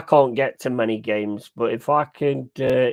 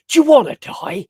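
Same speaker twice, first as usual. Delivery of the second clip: high, loud mock threat with a laugh; camp, hammed-up menace, higher and louder; mock glam rocker threat; 5 semitones higher, 9 dB louder than usual. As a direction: ham up a glam rocker picking a fight. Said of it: "turning around going".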